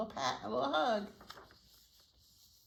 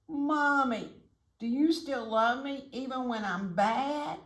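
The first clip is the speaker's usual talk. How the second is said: put-on higher-pitched pleading child voice; upset little chick; 7 semitones higher, 3 dB louder than usual. A narrator's reading. Upset chick asks mommy if she still loves him, high pleading.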